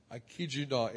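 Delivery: ordinary delivery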